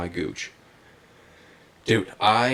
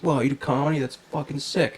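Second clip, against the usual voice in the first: higher-pitched, impressed imitation; retold excitement; 6 semitones higher than usual. Hairdresser learning her client performs comedy, imitated excitement. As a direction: lift the pitch in mock awe. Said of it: "she's all excited".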